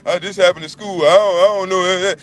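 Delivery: put-on whiny child. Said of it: "Your children come to you bitching, whining".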